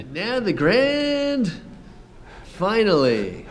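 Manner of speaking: slow, high, booming showman voice; grand announcer. Pretend. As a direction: announce it like a ringmaster. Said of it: "mock grand announcement".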